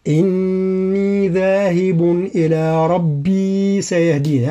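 slow Quranic chant, each madd drawn out; tajweed recitation. Shown as a demonstration. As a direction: recite "إني ذاهب إلى ربي سيهدين" with full tajweed. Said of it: "هكذا". (تلاوة مجودة بطيئة)

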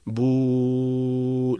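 "بو" as a very slow, sustained "buuu"; long madd vowel. Shown as a demonstration. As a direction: hold the u of "بو" until the breath runs out. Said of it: "لا ينتهي الصوت إلا عند انتهاء". (نطق "بو" بمد الواو حتى انتهاء النفس)

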